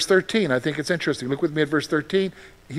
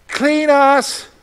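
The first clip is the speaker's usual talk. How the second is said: high, loud plea; pleading cry; 7 semitones higher, 5 dB louder, slower than usual. Retold or imitated. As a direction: cry out to be healed. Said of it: "They didn't even just say".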